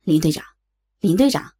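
low-voiced officer rousing someone; rescuer calling. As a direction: call to wake an unconscious captain. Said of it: "听到有人叫他".

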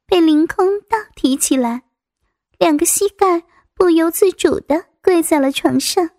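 usual speaking voice

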